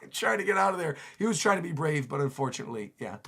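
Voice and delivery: plain reading voice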